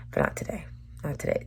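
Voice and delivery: whispered, breath only, almost to herself. Real, not acted. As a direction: drop to a bare whisper of defeat. Quiet repeated refusal after upbeat news.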